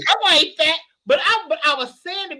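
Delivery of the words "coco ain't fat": raised sassy retort. Retold or imitated. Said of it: "It was like".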